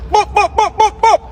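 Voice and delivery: rapid string of high hype boops; high-pitched boops. Pretend. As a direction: punctuate the intro with playful high boops. Host's sound effect in intro.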